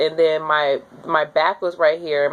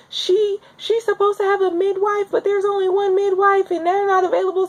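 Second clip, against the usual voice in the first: urgent nurse; 13 semitones higher than usual. Retold or imitated. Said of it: "she's like".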